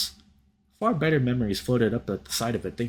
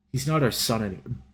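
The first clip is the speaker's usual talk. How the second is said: usual speaking voice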